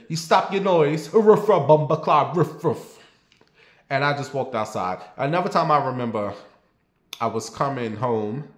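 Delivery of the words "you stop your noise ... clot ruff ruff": higher, louder mock patois with barks; dog arguing with the Jamaican man, patois and barks; dog arguing in Jamaican accent. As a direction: snap back like a barking Jamaican dog. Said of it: "the dog was arguing with".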